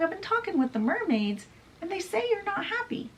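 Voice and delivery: put-on high-pitched, quick boy character; Peter, probing. Peter tells James what the mermaids said, high and quick.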